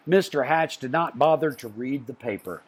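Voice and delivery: usual speaking voice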